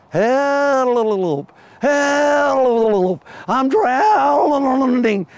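high, loud desperate shout; high drawn-out drowning rabbit calling for help; drowning cry. Acted. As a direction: cry out like you're going under. Rabbit sinking in the swamp.

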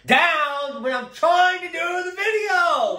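loud, high and slowed, shouting at the heckler; real annoyed shout. Real, not acted. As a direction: yell at them to calm down. Snaps at heckler during filming.

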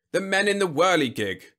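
loud, high-pitched exasperation; irritable. A narrator's reading. General explaining again to Whitlow, irritable. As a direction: spell it out impatiently. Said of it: "said the general irritably".

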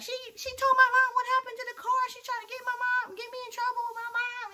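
high, fast, whiny mock of the ex. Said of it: "she's like".

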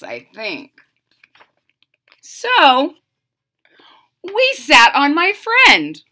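plain reading voice

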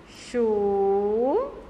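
"Churrasco" drawn out slowly in syllables. Shown as a demonstration. Teacher sounding out "Churrasco" slowly for pupils. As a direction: stretch every syllable of "Churrasco". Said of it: "Olha o som do O".

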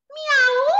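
high, loud cat "Miau"; cat meow. Acted. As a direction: meow like a playful cat for kids. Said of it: "el gatito le dijo".

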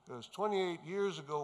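ordinary talk, nothing marked

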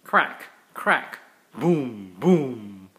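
thunder sounds. Acted. Dad reading to his kids, booming out the thunder. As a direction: rumble like a thunderstorm rolling in.

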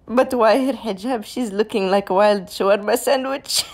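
high, loud, on the edge of laughter; amused mockery. Real, not acted. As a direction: tease while cracking up. Mocks hijab between bursts of laughter.

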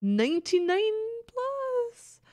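can't believe the number; shocked disbelief. Real, not acted. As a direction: pitch shoots way up, incredulous.

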